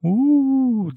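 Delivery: high, whimpering wail; a weepy, lifeless howl; high tearful wail from behind the door. A narrator's reading. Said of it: "ağlamaklı ve cansız sesi".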